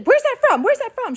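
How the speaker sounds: very high, loud mock alarm; alarmed demand. Mom questioning her kid about the Snapchat picture, alarmed demand. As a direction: replay a startled mom's rapid-fire questioning.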